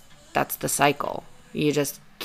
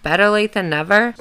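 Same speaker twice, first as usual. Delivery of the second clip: higher, louder sing-out with a rising end; odd lilting delivery; 4 semitones higher, 6 dB louder than usual. Real, not acted. Podcaster reacting to her letter finally clicking, odd high lilt. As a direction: lilt it up oddly, louder than usual. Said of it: "I don't know why I said it like that".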